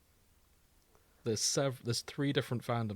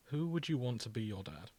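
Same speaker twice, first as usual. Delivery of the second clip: ordinary talk, nothing marked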